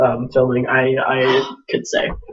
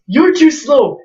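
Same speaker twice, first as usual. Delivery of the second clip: mock taunt; 9 semitones higher, slower than usual. Prankster shoves fake cripple.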